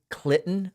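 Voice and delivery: Clinton with the N dropped, like "Clitton"; mimicked mispronunciation. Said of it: "she can't say Clinton".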